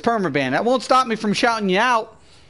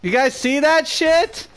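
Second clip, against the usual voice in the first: higher, incredulous complaint; real exasperation; 6 semitones higher than usual. Real, not acted. Gamer asks viewers if they saw the glitch, exasperated. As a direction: call out in disbelief, pitch climbing.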